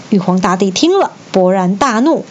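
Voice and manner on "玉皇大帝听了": usual speaking voice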